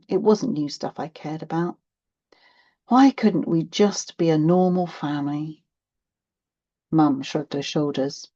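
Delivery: plain reading voice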